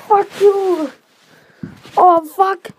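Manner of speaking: loud angry swearing; shouted cursing. Real, not acted. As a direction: yell the curses out. Swearing in pain after falling.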